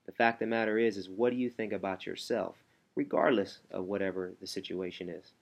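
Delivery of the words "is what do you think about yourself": ordinary delivery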